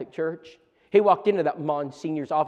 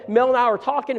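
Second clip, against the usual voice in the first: normal talking voice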